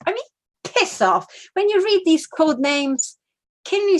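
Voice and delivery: high, loud "piss off" in disgust; incredulous high-pitched dismissal; scornful outburst. Real, not acted. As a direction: scoff at the silly code name. Scornful outburst at code name.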